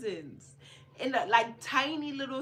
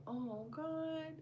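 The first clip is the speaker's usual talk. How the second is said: surprised oh God; 7 semitones higher, 9 dB quieter than usual. Retold or imitated. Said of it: "I'm like".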